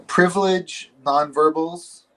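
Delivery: ordinary talk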